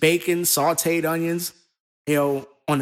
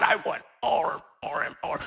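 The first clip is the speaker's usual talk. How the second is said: fading craving; 8 dB quieter than usual. Acted. Craving voiced in promo analogy.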